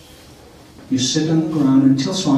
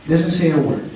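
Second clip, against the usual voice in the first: ordinary talk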